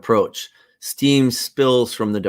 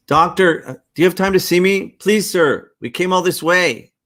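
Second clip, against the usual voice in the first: higher, louder, fast plea to the doctor; pleading townsperson; 9 semitones higher, 6 dB louder than usual. Acted. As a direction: beg the doctor for help, fast. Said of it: "Town resident, uh, ad libs".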